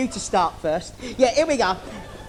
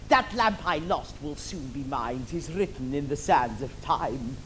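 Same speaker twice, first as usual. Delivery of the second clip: villain aside; 8 semitones lower, 6 dB quieter than usual. Acted.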